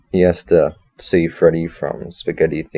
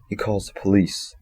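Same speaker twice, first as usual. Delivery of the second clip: ordinary delivery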